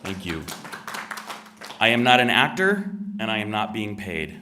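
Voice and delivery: usual speaking voice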